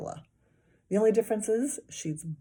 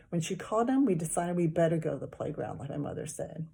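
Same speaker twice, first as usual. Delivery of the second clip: plain reading voice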